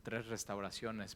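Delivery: ordinary talk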